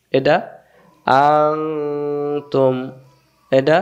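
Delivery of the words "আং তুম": আং held long and nasal before তুম; hidden nun. Shown as a demonstration. Teacher reviewing the example, long nasal আং then তুম. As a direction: hum through আং, then close on তুম. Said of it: "গোপন করে পড়তে হয়".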